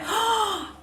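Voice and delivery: high, quick gasp; real high intake of surprise; mortified gasp. Real, not acted. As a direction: gasp at your own mistake. Realises she took word for typo.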